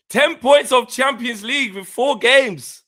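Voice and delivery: loud, high, drawn-out gloating; gleeful crowing. Real, not acted. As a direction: crow it slowly and loudly. Crowing over a rival's league trouble.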